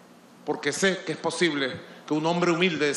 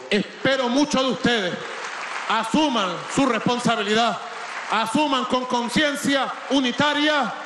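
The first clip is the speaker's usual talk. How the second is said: rallying shout; 5 semitones higher than usual. Real, not acted. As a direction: shout to fire up the crowd. Speaker closes congress rallying crowd. (grito de mitin enardecido)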